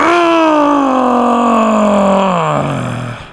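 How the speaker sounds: a long, high, loud crying sigh; over-the-top sobbing groan; huge wailing sigh. Acted. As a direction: wail the sigh out like you're in agony. Second exaggerated wailing sigh.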